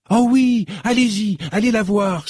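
put-on young shop girl crying out eagerly. A narrator's reading. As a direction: cry out with eager joy. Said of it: "s'écria Marlène".

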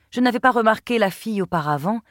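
ordinary delivery

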